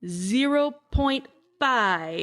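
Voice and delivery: slow, each word spaced out; drawn-out rating announcement; slow deliberate announcement. Shown as a demonstration. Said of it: "rating announcement".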